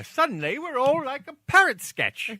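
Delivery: high-pitched mock British; bad British accent. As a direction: put on a silly British voice. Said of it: "the worst British accent ever".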